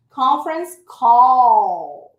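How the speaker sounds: "Conference call" slow and loud, final L held; "call" stretched, tongue behind the teeth for the L; model pronunciation. Shown as a demonstration. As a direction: teach "Conference call" with a long, drawn-out "call". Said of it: "a língua tem que ir atrás".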